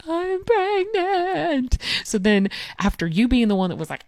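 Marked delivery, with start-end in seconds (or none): 0.1-1.8 much higher, very slow, wailing through tears; sobbing wail. Retold or imitated. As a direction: imitate blurting it out mid-sob. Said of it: "and I'm sobbing".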